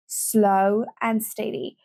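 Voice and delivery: ordinary talk, nothing marked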